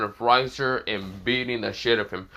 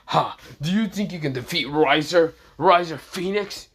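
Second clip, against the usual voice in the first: arrogant villain; 4 semitones higher, 4 dB louder than usual. Acted. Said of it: "this is where Riser says".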